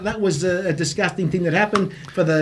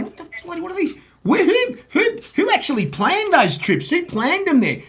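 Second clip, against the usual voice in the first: disbelief; 10 semitones higher than usual. Real, not acted. Incredulous rant about rubbish grounds.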